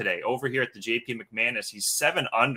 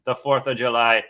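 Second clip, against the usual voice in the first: playful put-on Irish-Scottish brogue, loud greeting; lively Irish brogue hosting a golf show; mock Irish accent; 5 dB louder than usual. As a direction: open the stream in a hammed-up Irish accent. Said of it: "enough of the Scottish accent, Irish accent".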